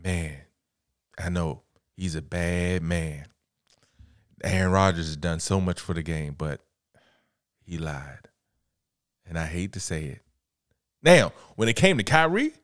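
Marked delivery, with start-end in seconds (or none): 0.0-10.2 imitating a grudging low-voiced admission; reluctant and hushed. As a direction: murmur it like a sorry confession. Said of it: "Stephen A got on there and said".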